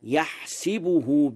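slow يحسبه with "i" after the sin; kasra on sin in يحسبه. Shown as a demonstration. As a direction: say يحسبه as yahsibuhu. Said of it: "بكسر السين".

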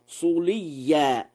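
صليا said suliyya; damma on sad. Shown as a demonstration. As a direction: begin صليا with a rounded "su". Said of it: "بضم الصاد".

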